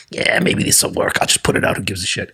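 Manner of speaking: breathy near-whisper, talking to himself; a shrugging whispered aside to himself; half-whispered inner thought. Retold or imitated. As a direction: mutter the thought under the breath. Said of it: "I was like".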